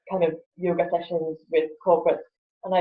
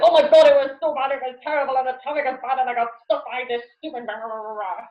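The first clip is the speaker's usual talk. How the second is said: higher, whiny complaining about the commute; a put-upon moan, hammed up a little; mock moaning; 5 semitones higher, faster than usual. Moaning to office colleagues about bad traffic, whiny and higher. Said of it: "arrive in the office and you're like".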